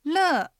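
乐 with a falling tone; fourth tone. Shown as a demonstration. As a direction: draw out 乐 as the pitch drops. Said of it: "四声下がります". (乐を下がる四声で)

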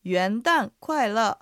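slow, syllable-by-syllable repeat; slow model pronunciation. Shown as a demonstration. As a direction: stretch every tone out for learners. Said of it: "今日のセンテンス復習しましょう". (一音ずつゆっくり復唱)

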